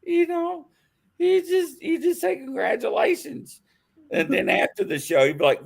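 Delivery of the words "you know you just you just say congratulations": high, squeaky voice from laughter; words squeezed high by leftover giggles; still laughing. Real, not acted. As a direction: push the advice out through laughter. Still laughing while advising Angela.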